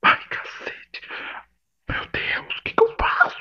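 unvoiced, panicked whisper. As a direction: whisper in horror so she won't hear. Sussurra em pânico. (sussurrando em pânico)

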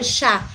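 "ya" said as "sha"; Buenos Aires "sh". Shown as a demonstration. Streamer explains the Argentine accent to viewers, "ya" pronounced "sha". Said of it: "reconocen el acento argentino como el".

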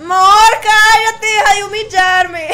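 loud, high playful protest; mock outraged shout. Real, not acted. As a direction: yell it at him as if humiliated, laughing. Protesta en broma ante burla. (grito de indignación en broma, agudo y fuerte)

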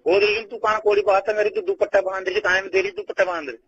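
usual speaking voice

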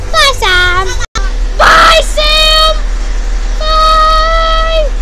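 high, loud parting shout; shouted goodbye. Real, not acted. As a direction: shout after him as he goes.

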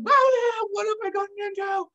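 high-pitched voice of a panicked bear; dismayed bear. Retold or imitated. Bear tumbled to the bottom of the hill, high dismayed wail. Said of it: "It's just kind of like".

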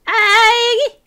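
« Aïe » aigu et fort; cri de douleur. Real, not acted. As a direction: petit cri perçant de surprise. In-game mishap. (glapissement aigu de mal)

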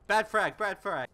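loud, high panicked callout; alarmed shout. Real, not acted. Grenade went wrong in combat.

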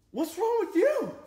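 high, startled mock alarm; alarmed question. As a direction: act suddenly woken and alarmed. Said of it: "I'm like".

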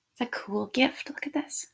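showing the gift to viewers in a whisper. Real, not acted. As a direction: share a secret in a whisper. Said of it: "whispered aside to audience".

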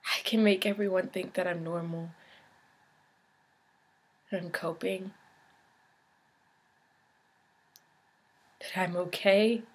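low-energy, soft, with long pauses; subdued confession. Acted. Patient admits faking being normal, soft and subdued. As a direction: confess it quietly, piece by piece.

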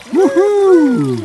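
high, loud whoop of excitement; excited whoop. Real, not acted. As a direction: let out a thrilled cheer. Cheer before the ice bath.

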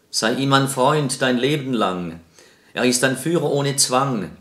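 loud, declaimed verse with measured pauses; projected, formal poetry reading; poem recitation. Acted. Recites a poem before the interview.

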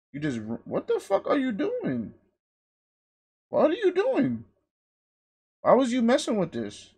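high, hushed, incredulous scolding; exasperated scolding. Real, not acted. Streamer asking someone who messed with this, exasperated. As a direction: question them high and softly, exasperated.

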